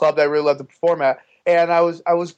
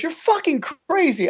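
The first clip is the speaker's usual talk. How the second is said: high-pitched imitated amazement; amazed Joe Rogan; 11 semitones higher than usual. Joe reacting to a call from the stage, amazed. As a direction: exclaim it in mock disbelief. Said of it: "He goes".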